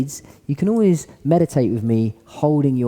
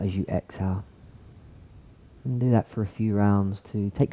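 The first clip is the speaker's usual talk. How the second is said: guided meditation; 2 semitones lower, 3 dB quieter than usual. Acted. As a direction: gently guide them into stillness. Leads a seated meditation.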